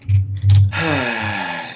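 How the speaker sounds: frustrated sigh. Real, not acted. Frustrated by broken stream audio.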